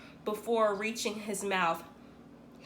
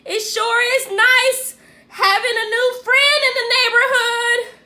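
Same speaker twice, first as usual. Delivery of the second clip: high, loud emphatic hint; pointed hint; 10 semitones higher, 10 dB louder than usual. A narrator's reading. Boy hinting loudly to Dad about his new friend. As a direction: say it so Dad gets the message. Trying to get his attention.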